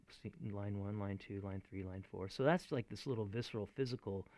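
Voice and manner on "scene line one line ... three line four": softly counting lines under the breath; soft counting on fingers; quiet counting. Shown as a demonstration. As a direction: murmur the count to yourself. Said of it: "just being like".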